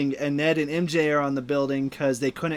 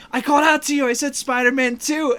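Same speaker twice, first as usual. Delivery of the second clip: insistent, higher and louder; raised-pitch quoted complaint; acted-out protest; 8 semitones higher, 4 dB louder than usual. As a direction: protest it like a movie character. Retelling film dialogue as Andrew.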